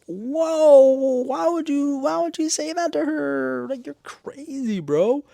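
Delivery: very high, drawn-out wail of disbelief; mock shock. Viewer reacting to Natalie's crazy line, exaggerated shock. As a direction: hold each phrase on one high note, slowly.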